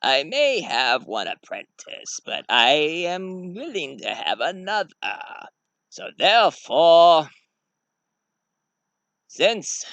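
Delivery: slow, drawn-out villain voice; ominous, measured menace; sinister Sith master. Acted. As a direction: draw it out like a dark lord grooming a pupil. Sith master names his apprentice.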